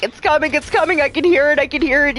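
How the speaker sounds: high, fast, panicky babble; freaking out. Retold or imitated. Gamer hearing a creature in the water, panicking. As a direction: gabble it in a rising panic. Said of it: "freaking out".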